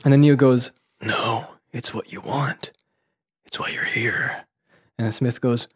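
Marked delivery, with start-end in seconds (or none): 1.0-4.4 hushed calm. Acted. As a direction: let it sink almost to a whisper. Acting Neo's calm answer.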